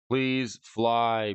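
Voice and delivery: ordinary talk, nothing marked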